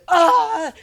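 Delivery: high, loud movie-style "ah"; short overacted exclamation; mock joyful cry. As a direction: cry out like a reunited father, hammed up. Father's cry in retold scene.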